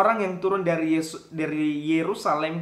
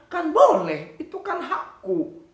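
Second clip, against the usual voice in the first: high, loud defiant squeal; a child defying the rules with a high shout; 10 semitones higher, 6 dB louder, slower than usual. Acted. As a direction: throw it back like a cheeky kid. Child defiantly claims rights.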